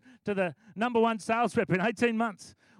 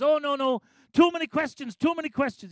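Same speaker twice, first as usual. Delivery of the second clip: flustered refusal; 6 semitones higher, 6 dB louder than usual. Retold or imitated. Ashley refusing to pray the prayer.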